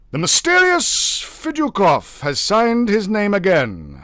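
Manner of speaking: loud, high, exasperated official; official Navagin, irritated. A narrator's reading. Navagin questioning his porter, irritated. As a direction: press the porter for answers.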